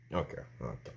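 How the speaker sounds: very low, soft resignation; subdued, quietly giving in; retold meek acceptance.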